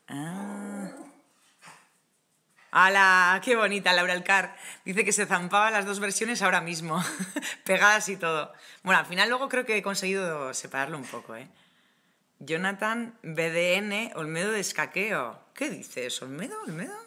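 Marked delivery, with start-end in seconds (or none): 2.7-4.6 loud, bright cry of delight; ¡Hala! shouted with joy; delighted exclaim. Real, not acted. Delighted at a comment. 16.2-17.0 puzzled disbelief. Real, not acted. Puzzled repeat of name.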